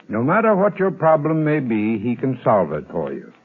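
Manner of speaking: normal talking voice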